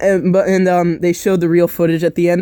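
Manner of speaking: ordinary talk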